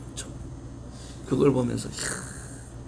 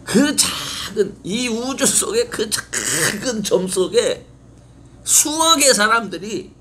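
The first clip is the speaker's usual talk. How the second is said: awed preaching; 9 semitones higher, 4 dB louder, slower than usual. Real, not acted. Preacher marvels at tiny Earth.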